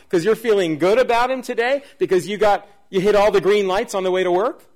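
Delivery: loud, disbelieving preacher's challenge; mock incredulous. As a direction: pile up the questions as if absurd. Mocks a shallow reason for thanks.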